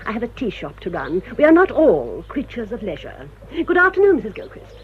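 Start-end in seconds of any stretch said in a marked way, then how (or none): 3.6-4.8 high sharp greeting dropping to a cold hush; icy farewell. Acted. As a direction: dismiss her with chill courtesy. Frosty dismissive parting.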